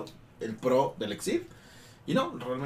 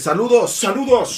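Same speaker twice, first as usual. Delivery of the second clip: greeting; 8 semitones higher, 12 dB louder, slower than usual. Real, not acted. Reads a chat greeting.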